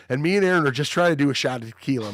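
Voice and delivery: normal talking voice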